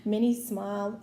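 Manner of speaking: plain reading voice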